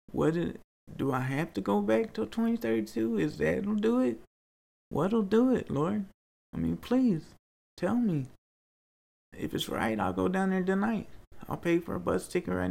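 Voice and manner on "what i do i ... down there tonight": real higher-pitched plea to the Lord; searching, raised-pitch questioning; pleading with God. Real, not acted. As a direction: beg for an answer, voice lifted.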